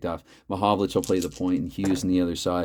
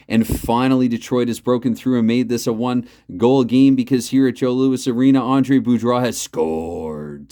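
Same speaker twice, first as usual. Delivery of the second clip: goal call; 4 semitones higher, 7 dB louder than usual. Acted. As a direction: announce the goal with restrained excitement. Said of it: "I know that I have a loud voice".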